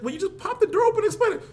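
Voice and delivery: quick, high-pitched wife imitation; wife's suggestion. Wife suggesting he explain to police, imitated fast and high. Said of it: "She's like".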